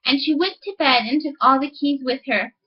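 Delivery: ordinary delivery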